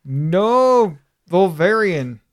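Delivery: ordinary talk, nothing marked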